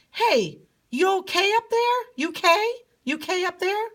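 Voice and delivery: high-pitched call to a faraway friend. Acted. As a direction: call up high to someone far off. King calls to distant friend.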